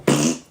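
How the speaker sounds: low lip-buzz fart sound; imitated fart noise. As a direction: blow a quick raspberry fart. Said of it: "so she can go".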